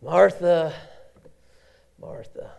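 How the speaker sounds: second Martha whispered; tender call dying to a whisper; gentle call fading. Acted. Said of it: "You know what he was saying?".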